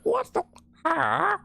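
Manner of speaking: very high squeaky huh; tiny creature confused. Retold or imitated. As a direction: squeak it out puzzled. Voicing a confused game creature.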